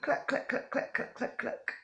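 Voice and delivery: breathy unvoiced clucking; playful chicken clucks; hen clucks. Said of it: "Can you all say cluck?".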